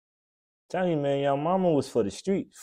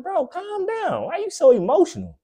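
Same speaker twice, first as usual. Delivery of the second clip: mock friend voice. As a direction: squeak it out like a rattled buddy. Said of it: "you like".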